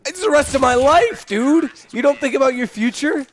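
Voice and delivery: mock outrage. Real, not acted. Mock-outraged at being rushed.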